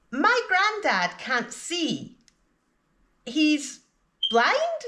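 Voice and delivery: pause, then "blind" rises sharply as a question; unsure of "blind", lift it high to ask; rising intonation on "blind". Shown as a demonstration. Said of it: "you can use rising intonation on the word that you're not sure about".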